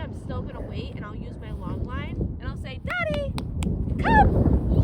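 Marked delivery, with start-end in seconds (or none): 2.9-4.8 high, loud, eager call to the dog; excited recall. Shown as a demonstration. Trainer calling her dog back, excited and loud. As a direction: sing her name out bright and thrilled, then shout come. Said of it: "And I got really excited".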